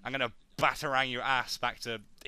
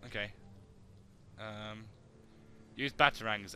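usual speaking voice

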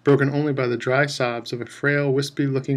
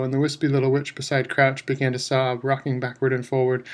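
usual speaking voice

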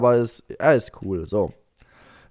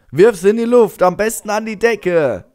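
high, raised cheer of encouragement; excited egging on; 6 semitones higher, 5 dB louder than usual. Pretend. Player eggs on game father tossing baby, excitedly. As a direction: urge it on with playful gusto.

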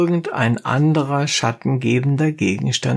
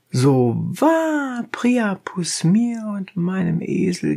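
dentist Struzion; 5 semitones higher than usual. A narrator's reading. Dentist swears by Priapus.

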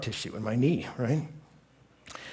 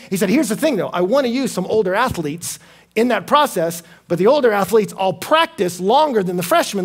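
loud, high, driving sermon delivery; preaching at full force; 9 semitones higher, 12 dB louder than usual. Acted. Preacher voicing the campus minister's plan to the crowd, full force. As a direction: push the story hard to the crowd.

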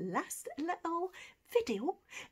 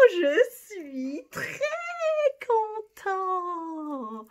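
higher and louder, slow proud très content; delighted; 10 semitones higher, 9 dB louder, much slower than usual. Shown as a demonstration. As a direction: beam out je suis très content. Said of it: "very pleased with yourself".

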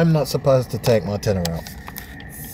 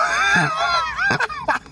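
shrieking laugh. Real, not acted. Man laughing at the jammed cash machine, shrieking. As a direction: burst out in a shrill laugh at the absurdity.

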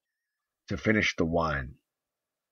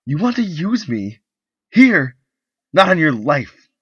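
much higher and louder, scornful; furious refusal; 10 semitones higher, 12 dB louder than usual. Acted. Wife refusing her husband's advances, furious high shout. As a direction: spit out the refusal.

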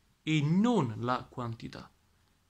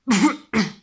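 a real, loud chesty cough; 7 semitones higher, 11 dB louder than usual. Real, not acted. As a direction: cough hard once, from the chest. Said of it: "scusate la tosse".

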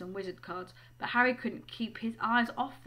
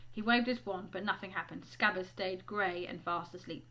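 usual speaking voice

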